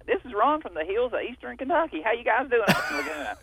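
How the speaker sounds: put-on southern country voice; southern accent. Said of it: "I got this southern voice going on".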